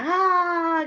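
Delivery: sudden high muffled scream into a pillow. Shown as a demonstration. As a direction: let out one short scream as if into a pillow. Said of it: "Di dalam bantal saya teriak".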